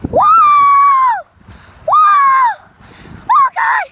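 high, very loud whoops; thrilled screams. Real, not acted. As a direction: scream with joy and fright. Racing downhill, screaming.

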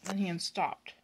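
plain reading voice

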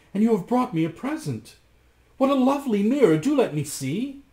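high, eager exclaiming; excited cry. A narrator's reading. Anne spots the mirror in Rasmus's hand, high and eager. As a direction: exclaim with lifted, eager pitch. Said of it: "cried Anne".

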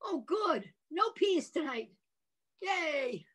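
high happy cheer; bright high glad voice. Shown as a demonstration. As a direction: sound pleased and relieved.

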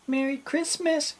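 tiny, very high mouse voice; mouse calling out. A narrator's reading. Mouse calling after the captain, high piping greeting. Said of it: "called the mouse".

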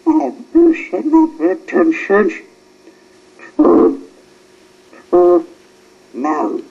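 high, loud shocked stammer; loud startled stammer. A narrator's reading. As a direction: caught out, stumbling over words.

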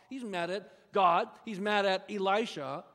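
usual speaking voice

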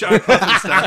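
loud, laughing, drawn-out punchline. Real, not acted. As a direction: blurt it out through laughter. Laughing exclamation.

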